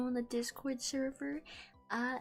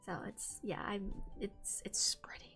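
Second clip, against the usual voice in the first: whisper; 4 semitones lower, 10 dB quieter than usual. Real, not acted. Whispered repeat of phrase.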